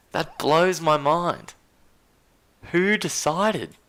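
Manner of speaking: high-pitched, loud disbelief; incredulous outburst. Real, not acted. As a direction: cry out in utter bafflement, voice shooting up. Baffled by the team rankings.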